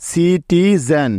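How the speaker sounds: Citizen drawn out slowly, syllable by syllable; teacher saying citizen slowly; slow full word. Shown as a demonstration. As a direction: stretch citizen for the learners. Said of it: "citizen শব্দের বানানটা কেমন হলো".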